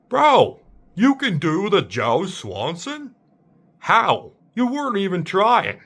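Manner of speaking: high-pitched, astonished outburst in the dad voice; mock disbelief. Stunned at own impression, asking self how, in mock disbelief. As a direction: question yourself in stunned disbelief.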